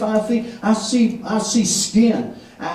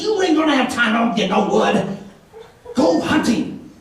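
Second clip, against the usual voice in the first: high, insistent mock protest; imitated scolding; 3 semitones higher than usual. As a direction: send him off in a high, nagging voice. Quoting man scolding him.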